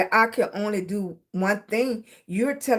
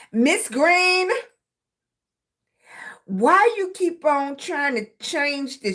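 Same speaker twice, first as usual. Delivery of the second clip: higher, drawn-out calling of the name; mock exasperated teacher; 3 semitones higher, slower than usual. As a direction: call out a pupil's name, fed up. Acting exasperated hair school teachers.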